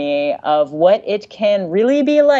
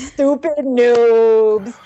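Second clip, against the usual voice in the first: slow mock taunt; much slower than usual. Taunting sign-off in letter.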